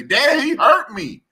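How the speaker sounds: high, loud and slow, a daughter crying out she's hurt; a father retells his daughter's hurt call, voiced high, loud and slow; voicing hurt daughter. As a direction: mimic a hurt child on the phone. Daughter's call retold, hurt child.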